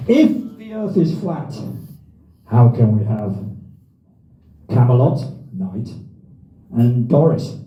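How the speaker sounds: put-on jokey Southern English accent, showman style. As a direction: ask it like a posh Southern English host. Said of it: "Did you like my Southern English accent".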